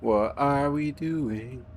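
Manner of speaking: long, lilting baffled question; drawn-out bewilderment. Real, not acted.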